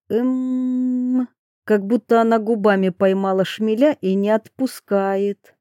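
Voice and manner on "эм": long, level buzzing "mmm"; angry hum. A narrator's reading. Narrator imitates a bumblebee caught on her lips, angry hum. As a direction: hum the letter like a trapped bumblebee. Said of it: "гудел сердитой".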